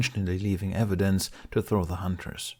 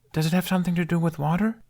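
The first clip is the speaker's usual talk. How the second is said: put-on high, quick kid voice; a drowsy boy guessing; voiced young boy; sleepy little boy; 10 semitones higher, faster than usual. Child character voice.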